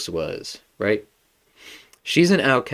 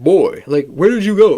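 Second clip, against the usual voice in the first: loud, high-pitched mock yell for a son; imitated scream; 9 semitones higher, 7 dB louder than usual. Kratos calling out for his lost son, imitated scream. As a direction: call out loudly, imitating a worried father. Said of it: "screaming Atreus' name".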